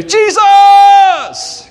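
very high, loud shouted cue. Shown as a demonstration. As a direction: belt it out for the kids. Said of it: "I need you to say".

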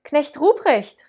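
high, slow call of Knecht Ruprecht; bright calling voice. Acted. Christkind calling to Ruprecht in the forest, bright call. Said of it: "rief mich mit heller Stimme an".

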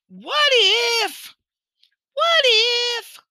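high, drawn-out What if; dramatic what if. Acted. Motivational speaker to audience, high and dramatic. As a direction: ring out What if like a big question. Emphatic repetition of the theme.

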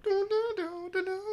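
high sung dun dun dun sting; high dun-dun-dun sound effect; mock dramatic music. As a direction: hum the dramatic movie cue in falsetto. Imitating dramatic movie music.

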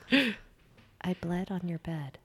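soft, very slow, flat confession; blunt and small. Retold or imitated. Confessing to boyfriend on the bed, soft and slow. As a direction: drop it quietly, word by word. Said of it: "Just like that?".

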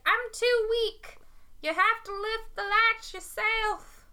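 high, slow Southern grandmother; southern accent. Acted. Weak grandmother to the wolf at her door, high Southern accent. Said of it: "has a southern accent".

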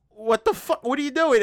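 mock outraged disbelief. Acted outcry at the church's betrayal.

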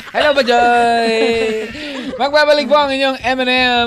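upbeat radio announcer. Acted. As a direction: sign off to the break like a DJ. DJ going to break. (boses ng DJ sa radyo)

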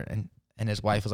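usual speaking voice

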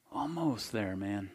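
very soft, low and gentle, almost a murmur; tender compassion. Acted. Teacher looks at the young man with compassion, very soft and tender. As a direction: gently let him down, full of compassion.

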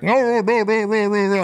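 high, muffled, pleading no no no; muffled mumbling. Retold or imitated. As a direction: mumble it from far away, gagged. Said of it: "mumbling, like".

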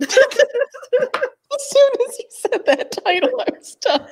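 high, slow laughing. Real, not acted. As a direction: barely talk through laughter. Laughing hard at title.

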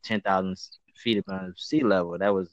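ordinary talk, nothing marked

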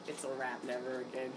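worn out and done, barely lifting the voice; deflated. Retold or imitated.

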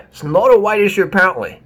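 scornful echo of the claim; mocking disbelief. Shown as a demonstration. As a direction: throw his words back at him.

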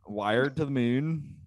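drawn-out Irish lilt, slow; slow Irish accent. Shown as a demonstration. Asked for an Irish accent, he drags it out slowly. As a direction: stretch it out in an Irish voice. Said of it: "please say it with an Irish accent".

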